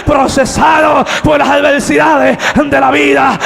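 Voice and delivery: loud, rising sermon cry; preaching at full force. Real, not acted. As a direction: preach it out to the congregation. (predicación a todo pulmón)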